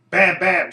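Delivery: imitated gunshots. Recapper acting out Quinn shooting Skye twice, loud imitated gunshots. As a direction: punch out the bangs like pistol shots.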